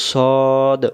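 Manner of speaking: "সদ" with one long rounded "o"; rounded-mouth pronunciation. Shown as a demonstration. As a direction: round the mouth and hold "সদ" as a drawn-out "sawd". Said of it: "মুখটা গোল হবে". ("সদ" মুখ গোল করে লম্বা টেনে)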